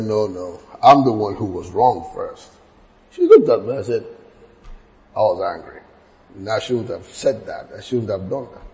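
low, soft and gentle; tender apology. Retold or imitated. Father apologising softly to his daughter after flogging her. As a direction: speak low, as if making peace.

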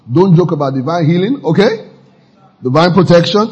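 usual speaking voice

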